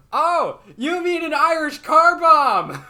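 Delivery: high, loud, drawn-out mock enthusiasm; oblivious perky server. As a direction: squeal it slowly like an over-keen bartender. Mock-cheerful server in retold exchange.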